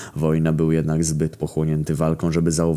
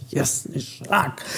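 very slow, higher whispered curse; slower panicked whisper; 9 semitones higher, 5 dB quieter, much slower than usual. A narrator's reading. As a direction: drag it out in a terrified whisper. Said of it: "bełkotał Zaraza".

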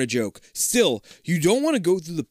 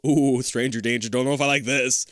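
ordinary talk

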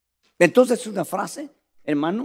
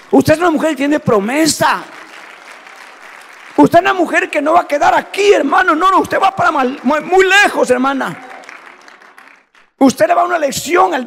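shouted, higher and louder sermon exhortation; preaching at full force; 8 semitones higher, 10 dB louder than usual. Acted. Preacher exhorting the women of the congregation, shouting. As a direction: proclaim it like a fiery preacher.

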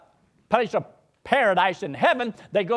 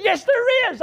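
retold insistence; 6 dB louder than usual. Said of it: "She says".

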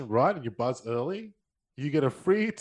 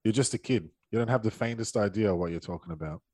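movie quote reading; 6 semitones lower, 4 dB quieter than usual. Acted. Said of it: "I won't do the accent".

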